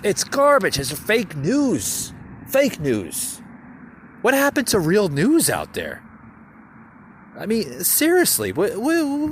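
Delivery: loud, high outraged rant. Real, not acted. Podcaster to listeners, loud high outraged rant. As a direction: rail against fake news. Ranting at fake news.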